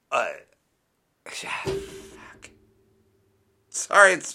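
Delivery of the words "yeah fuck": a breathy whispered "fuck"; whispered curse. Real, not acted. Host mutters to himself after the loss, whispering. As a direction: let it out under your breath.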